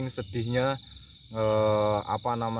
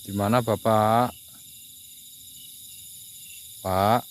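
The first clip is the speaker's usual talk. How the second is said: loud, slow call into the dark; loud, slow call to a hidden presence; 8 dB louder, slower than usual. Real, not acted. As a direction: call out to an unseen spirit, drawing it out. Calling out to a spirit.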